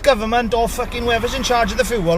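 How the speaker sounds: loud, furious rant; really fuming, let rip. Real, not acted. Fuming driver ranting at the government about fuel, loud angry rant. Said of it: "I'm bloody fuming".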